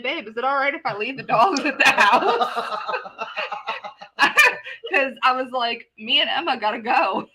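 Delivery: talking through real laughter; can't keep a straight face while retelling; laughing at her own panic as she tells it. Real, not acted. Retelling her panicked phone call.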